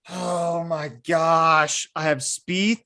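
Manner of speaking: ordinary delivery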